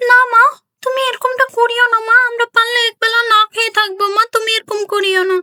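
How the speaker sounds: high, earnest plea; pleading daughter. Acted. Daughter begs mother not to water the milk, high and earnest. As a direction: beg her not to cheat.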